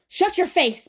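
high, loud furious scream. A narrator's reading. Crazed Ben cutting Thomas off, screaming. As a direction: scream him into silence. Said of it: "Ben screamed".

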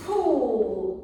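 loud, drawn-out "pu"; long falling demo shout; playful syllable burst. Shown as a demonstration. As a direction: blow the syllable out for a child. Modelling syllables for a child.

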